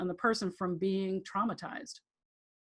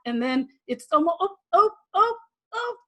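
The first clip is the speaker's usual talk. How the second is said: mock climax moans; 9 semitones higher, 5 dB louder than usual. Shown as a demonstration. Demonstrating a fading orgasm.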